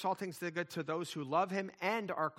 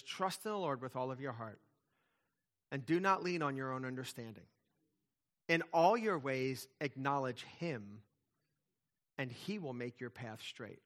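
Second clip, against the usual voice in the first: scripture reading; 3 semitones lower than usual. Acted. Reads scripture verse aloud.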